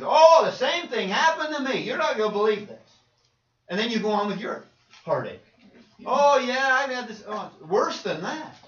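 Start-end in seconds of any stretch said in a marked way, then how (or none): 0.0-3.0 much louder and higher, gushing over you; mock self-absorbed friend. Friend cutting in on your heartache, loud gushing mock voice. 6.0-8.6 much louder and higher, gushing over you; mock self-absorbed friend. Friend cutting in on your heartache, loud gushing mock voice.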